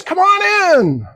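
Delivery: high, loud, slow welcoming call; hearty invitation. Retold or imitated. The host waves the candidate inside, loud slow welcome. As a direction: draw it out like a big welcome.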